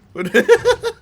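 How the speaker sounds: sudden loud laugh at own joke; loud high laugh. Real, not acted. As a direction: burst of high cackling laughter.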